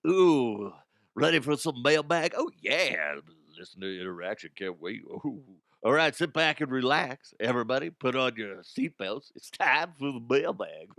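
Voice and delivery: hammed-up radio DJ hype; radio guy. Shown as a demonstration. Host mocking his own mailbag intro, too radio guy. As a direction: overdo the smooth radio-host patter. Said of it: "I was going too radio guy with it".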